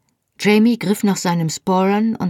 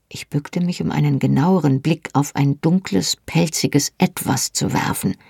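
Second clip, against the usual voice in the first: ordinary delivery, nothing marked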